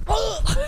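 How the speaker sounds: high imitated groan; mock groan "ugh". Imitating the sleepwalker's reply with a high groan. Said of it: "you're just like".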